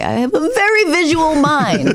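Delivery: high-pitched mock-upset mother. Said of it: "she would get very upset".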